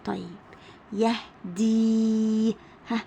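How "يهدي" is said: يهدي with the final ee held very long; teacher stretching the ending of يهدي; elongated final vowel. Shown as a demonstration. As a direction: sound out يهدي slowly on a steady, sustained ee. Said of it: "صوته بيكون طويل".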